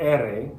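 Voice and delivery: "R" as Spanish E-re, one flap of the tongue tip; single-tap ere. Shown as a demonstration. Teacher demonstrating for students, single tongue flap. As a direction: tap once in "R", never roll it. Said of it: "tip of my tongue just goes bloop once".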